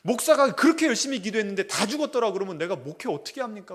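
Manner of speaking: a pastor begging God for his mother, loud and desperate; desperate prayer. Retold or imitated. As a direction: stake your life on this plea, pushing harder as you go. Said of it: "목숨을 걸고 기도했답니다".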